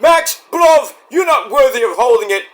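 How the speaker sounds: high, loud mock wrestler rebuke; hammed-up shouted promo; Will Ospreay. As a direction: imitate a British wrestler calling out a rival. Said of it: "And he's like".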